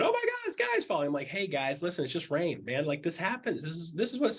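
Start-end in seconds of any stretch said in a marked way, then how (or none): 0.0-1.1 mock panic. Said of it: "they're going".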